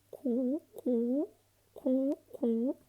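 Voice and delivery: high cooing pigeon. Acted. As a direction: coo like a city pigeon. Imitating a pigeon.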